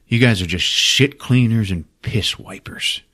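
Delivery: contemptuous whisper. Retold or imitated. Boss whispers insults at cleaners, contemptuous whisper.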